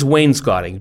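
"wainscoting" with "scot" rhyming with "hot"; preferred pronunciation. Shown as a demonstration. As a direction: say WAYNE-skot-ing for "wainscoting". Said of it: "I think it's wainscoting".

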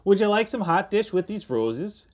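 high, lilting Midwestern hostess; mock Minnesota accent. Co-host offering hot dish with roses, mock Minnesota accent. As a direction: offer it in a hammed-up Minnesota lilt. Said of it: "It's like a Minnesota thing".

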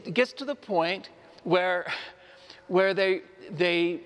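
usual speaking voice

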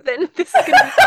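laughing while talking fast; giggly, rushing the words. Real, not acted. Joking about dying, laughing.

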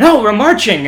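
loud mock chief snapping; mock chief yell. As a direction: bark the correction. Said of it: "he's like".

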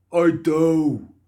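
loud, high, stuffed-nose protest; broken-nose voice. Acted. Injured guard protesting to Molly, stuffed-nose cry. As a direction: wail it through a blocked nose.

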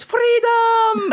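very high-pitched mock battle cry; Scottish spider's shout. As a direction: cry it out like a tiny rebel. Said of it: "one of them shouts".

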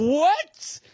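high, loud shocked What. Retold or imitated. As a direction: blurt out in shock. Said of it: "I go".

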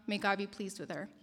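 ordinary talk

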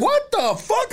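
squeaky high outburst; shocked. Real, not acted. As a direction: yelp it out in shock. High exclaim.

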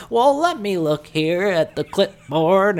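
put-on high, slow old-timer voice; old man voice. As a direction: read it out like a frail old man. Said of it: "And he goes".

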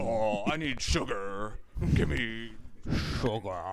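slow drawn-out alien villain voice. Retold or imitated. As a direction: drawl it like the bug alien.

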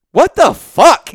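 high, loud mock disbelief; astonished. Wife to husband once the door closes, imitated as incredulous. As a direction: burst out appalled, imitating her shock. Wife reacting after intruder leaves.

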